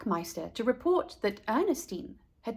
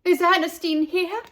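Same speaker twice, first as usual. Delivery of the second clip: higher, louder call into the pool hall; 7 semitones higher, 5 dB louder than usual. A narrator's reading. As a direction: call out, searching for her. Parents calling out searching.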